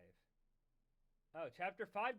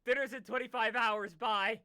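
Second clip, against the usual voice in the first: yelling across the room; 4 semitones higher, 13 dB louder than usual. Retold or imitated. Mocking mom calling out.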